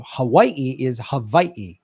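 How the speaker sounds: second "Hawaii" said ha-VAI-ee, W as V; traditional Hawaiian pronunciation. Shown as a demonstration. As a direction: say the last "Hawaii" with a V and a break before the final i. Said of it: "the actual traditional pronunciation".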